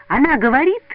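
high, loud outburst; angry shout. A narrator's reading. Петя yells in his friend's face, angry shout. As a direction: shout it straight at him. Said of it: "закричал он в лицо товарищу".

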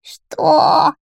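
high, outraged witch screech; high, hoarse cry of indignation; hoarse witch roar. A narrator's reading. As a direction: screech it out like an insulted hag. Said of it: "рявкнула Акеба своим хриплым голосом".

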